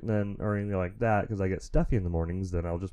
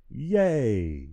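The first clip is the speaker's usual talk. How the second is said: little cheer; 2 semitones higher than usual. Real, not acted. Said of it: "That was super exciting for me".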